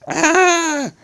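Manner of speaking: high-pitched yelled cry; high scream. Acted. Electronic and Rubber hit at once, high scream. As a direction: let out a sharp high scream. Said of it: "yelled Electronic and Rubber".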